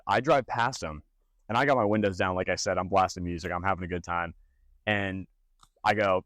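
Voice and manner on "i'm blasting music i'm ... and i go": ordinary talk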